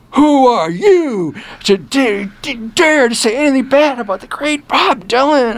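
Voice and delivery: rabid fan. Retold or imitated. Angry [name] fan scolding the reviewer for trashing him, in a high indignant mock voice. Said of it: "the fans were rabid".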